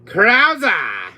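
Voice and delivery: loud, high shouted flourish; exclaimed name. Acted. Villain listing his fellow men, exclaiming. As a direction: belt out the name.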